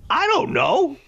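very high-pitched, tentative reply; squeaked-up hedging; mock uncertain answer. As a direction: go way up as if unsure. Acts out his reluctant answer.

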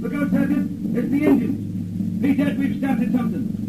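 high, urgent alarm at the attack; alarmed warning. Acted.

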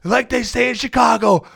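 high, loud, slow gloating taunt; taunting yell. Retold or imitated. Taunting Cub fans in their bar, laughing high shout. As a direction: rub it in, laughing as you shout. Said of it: "I'm yelling".

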